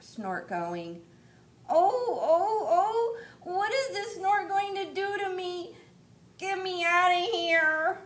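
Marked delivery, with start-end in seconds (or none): 1.7-8.0 high, loud, half-crying wail; a chick whimpering in fright; terrified baby bird. Acted. Baby bird carried up by the snort, crying.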